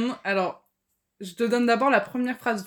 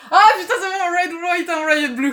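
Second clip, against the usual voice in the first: high, loud and laughing with excitement; thrilled guess, laughing loud; excited laughing; 8 semitones higher, 5 dB louder than usual. Real, not acted. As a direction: burst out laughing with relief at the answer. Guessed the book title.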